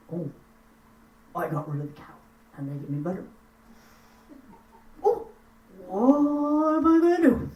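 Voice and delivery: a hint of a dismayed gnome; mild sing-song worry; worried Nissen. Nissen regrets losing the cow.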